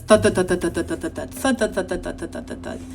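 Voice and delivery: rhythmic washing-machine thuds, fading away. Acted. Teacher reads washing machine sounds to children, rhythmic thudding. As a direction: chant a thudding machine, letting it die down. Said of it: "the washing machines? It says".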